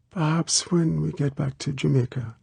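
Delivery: put-on high girlish voice, gently bargaining; small-voiced girl, hopeful. A narrator's reading. Girl putting it off until Jamaica, small hopeful voice.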